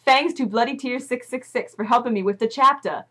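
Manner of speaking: normal talking voice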